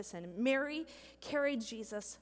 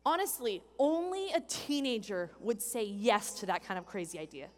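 usual speaking voice

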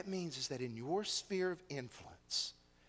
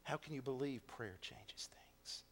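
hushed mocking question; 4 semitones lower, 9 dB quieter than usual. World mocking believers.

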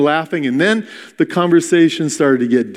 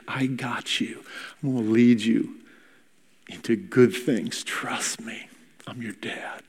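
much softer, breathy near-whisper; gentle whisper; 2 semitones lower, 10 dB quieter than usual. Acted. God to the listener, a soft tender whisper. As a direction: whisper it close, like a comfort. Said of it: "whispers in your ear".

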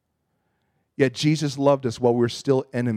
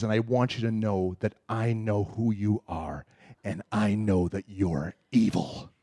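low menacing accuser; 5 semitones lower, 6 dB quieter than usual. Retold or imitated.

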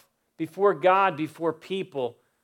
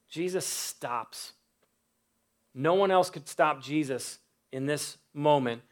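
ordinary talk, nothing marked

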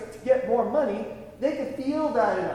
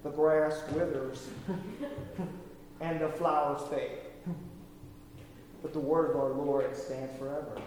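put-on Southern accent; 5 semitones lower, 6 dB quieter than usual. Imitating his pastor reciting the verse, Southern accent. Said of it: "he'd say it in his, uh, Southern accent".

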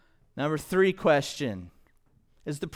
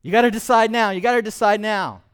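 higher and louder, pushing hard on gotta; high-pressure salesman; 7 semitones higher, 8 dB louder than usual. Retold or imitated. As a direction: bark the deadline like a pushy salesman. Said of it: "High pressure".

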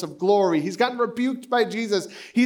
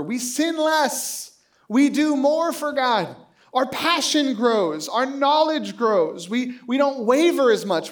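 building sermon cadence; 5 semitones higher, slower than usual. Acted. Preaching growth hopes.

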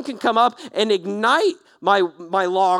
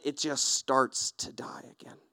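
softer, unvoiced trailing hush; whisper; 6 semitones lower, 10 dB quieter than usual. Real, not acted. Preacher describing passion fading, whisper. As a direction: let it die away in a whisper.

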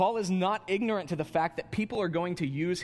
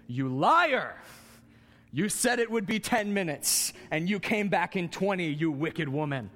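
put-on loud, indignant childish accusation; mock outraged accusation; 4 semitones higher, 3 dB louder than usual. Child yelling at parents for coming back late. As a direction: call them liars like a wronged kid.

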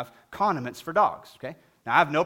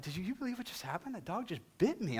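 hushed disbelief; 4 semitones higher, 9 dB quieter than usual. Retold or imitated. Telling friend in disbelief.

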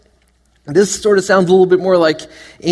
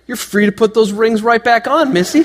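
Paul scolding; 6 semitones higher than usual. Retold or imitated. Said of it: "The Apostle Paul would say".

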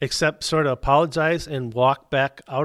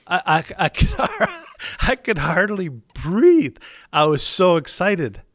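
breathless, giddy laughter while talking; real laughter breaking into the words; laughing through words; 5 semitones higher than usual. Real, not acted. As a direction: laugh it out, barely able to speak. Overwhelmed recalling the gift.

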